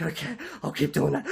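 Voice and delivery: acted out-of-breath agreement, ending in gasps; agree, then gasp for air; exhausted gasping. Rider worn out on the exercise bike, mock exhausted. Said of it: "I'm almost dying at this point".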